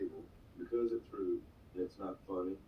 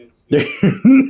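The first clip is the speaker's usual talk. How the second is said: slow, loud, higher demand; 28 dB louder, slower than usual. Acted. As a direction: shout it slowly, much louder. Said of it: "I told you to say".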